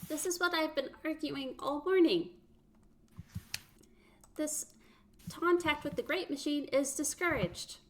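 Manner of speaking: high, tired machine voice; robot M1A voice. Acted. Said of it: "M one A says".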